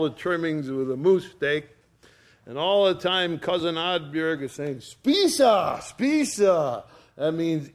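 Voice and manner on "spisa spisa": high, insistent dinner-table urging; urging hostess. Retold or imitated. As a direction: imitate a cousin pressing food on guests. Acting cousin urging guests to eat.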